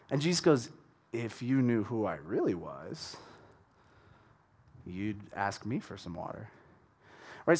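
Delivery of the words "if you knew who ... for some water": low, hushed, teasing reply; playful comeback. Acted. Jesus intriguing the Samaritan woman, quietly playful. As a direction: give it back softly, with quiet intrigue. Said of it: "I think he's a little bit playful".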